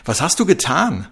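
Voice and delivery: loud high scream of accusation; shouting. A narrator's reading. Seela screaming at Trixie on the bunker roof. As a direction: scream it at her. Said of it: "schrie Seela Trixie an".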